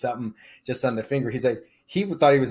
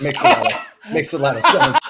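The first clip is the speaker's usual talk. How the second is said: laughing, louder; 4 semitones higher, 11 dB louder than usual. Real, not acted. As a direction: laugh out the irony. Laughing about irony.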